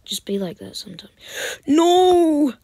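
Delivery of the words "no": sudden loud high "No!"; shout. Real, not acted. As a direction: cry out in dismay. Loses a brawl.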